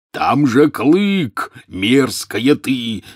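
loud, high, drawn-out outraged bellow; Hagrid's roar. A narrator's reading. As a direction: roar slowly, stretching the words. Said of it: "взревел Хагрид". (протяжный возмущённый рёв)

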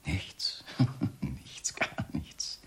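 breathy teasing whisper with a laugh; sly whisper. Acted. Husband whispering mockingly to his rival. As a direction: whisper it slyly, mocking the denial. Sly denial after insinuation.

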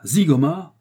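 loud, high, rising astonished question; startled exclamation. A narrator's reading. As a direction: echo the name in shock. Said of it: "Le détective américain sursauta". (cri de surprise interrogatif)

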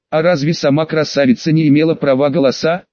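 ordinary delivery

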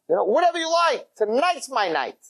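high-pitched, exuberant outburst; elated. Acted. Diner to waitress on his big night, elated. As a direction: let it soar with giddy abandon.

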